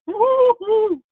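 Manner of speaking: laughing high whoop of delight; joyful whoop. Real, not acted. Preacher excited by her own point, laughing whoop. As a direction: let out a laughing woo-hoo.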